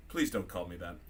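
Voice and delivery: low, soft, weary objection; subdued, unamused mutter; quiet deadpan reply. Acted. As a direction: answer low and under the breath. Lando's deadpan reply in the story.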